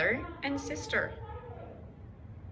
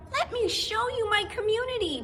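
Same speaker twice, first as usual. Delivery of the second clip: much higher, proud little cat voice; 12 semitones higher, faster than usual. Acted. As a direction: show off the town proudly. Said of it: "He was very proud of his town".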